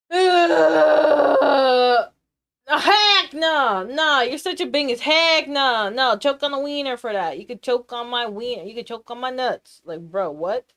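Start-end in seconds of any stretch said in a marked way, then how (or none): 0.1-2.1 loud drawn-out holler; one long sustained shout; high, loud held cry; long yell. Real, not acted. Long yell before rant. 2.7-9.8 loud, rapid rant; loud hammed-up scolding; indignant refusal. Refusing with mock insults.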